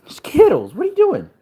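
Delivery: high cooing voice for the puppy; high sing-song pet talk. Real, not acted. Baby talk to dog.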